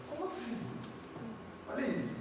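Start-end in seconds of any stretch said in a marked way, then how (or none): 0.1-0.7 faking sleepy. Pretend. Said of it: "eu fingi que eu tava lá".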